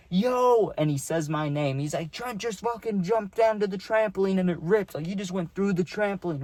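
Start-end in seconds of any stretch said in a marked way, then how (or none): 0.1-0.7 a much higher, slightly louder "yo"; Mario calling out to the host, high mock yell; retold yell. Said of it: "Dude, he yells". 2.1-6.4 higher, eager tattling voice; Mario reporting the rip to the host, higher and eager; excited friend telling on him. Retold or imitated. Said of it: "Dude, he yells".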